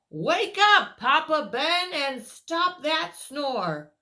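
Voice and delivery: loud high wake-up call; sing-song call to wake him up. Acted. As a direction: rouse the sleeper with a cheery shout. Character calls sleeper awake.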